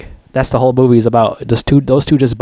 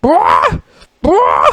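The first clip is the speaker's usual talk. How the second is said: imitating the bird. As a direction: squawk it out like the noisy bird. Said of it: "It's like".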